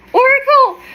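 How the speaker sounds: high, thrilled squeal on the word; excited squeal. Real, not acted. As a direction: squeal it out with delight. Excited unboxing.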